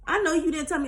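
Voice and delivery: high-pitched, indignant comeback; arguing with ego. Acted. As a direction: squeal back at your own ego in mock outrage. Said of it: "I be arguing with my ego".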